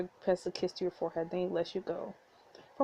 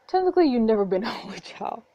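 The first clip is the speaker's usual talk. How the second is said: laughing; 3 semitones higher, 6 dB louder than usual. Acted. Teasing with a laugh.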